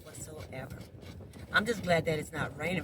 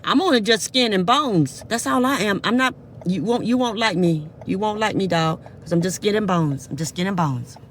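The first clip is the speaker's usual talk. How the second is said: worked up; 8 dB louder than usual. Real, not acted. Pleading with dog loudly.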